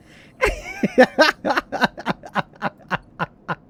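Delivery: loud, high burst of laughter; gleeful cackle; delighted laugh. Real, not acted. Big laugh.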